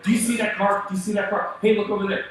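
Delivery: jittery backseat warnings; nervous wife passenger. Retold or imitated. Wife warning husband driving, anxious and loud. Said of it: "It's like having your wife drive with you".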